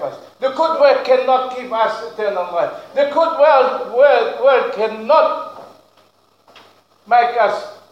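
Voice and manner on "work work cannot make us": ordinary delivery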